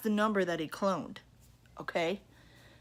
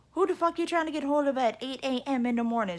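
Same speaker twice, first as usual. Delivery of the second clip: higher, louder, quick nosy neighbor; 6 semitones higher, 4 dB louder, faster than usual. Retold or imitated. Neighbor confronting a stranger at the door, higher, louder, fast. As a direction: fire it off like the nosy neighbor across the way. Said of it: "be like".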